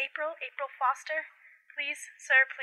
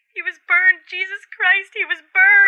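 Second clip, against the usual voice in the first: terrified cry; 3 semitones higher, 10 dB louder than usual. Acted. Mother sees burned dead son.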